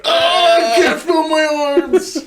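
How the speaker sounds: very high, loud wail; comic high-pitched shriek of distress; mock panicked scream. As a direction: scream in hammed-up alarm. Acts out reaction when he sneezes.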